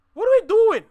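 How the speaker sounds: high, loud cry of disbelief; exasperated shout. Real, not acted. Ranting at fellow Canadians, loud exasperated shout. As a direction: throw the question out in loud frustration.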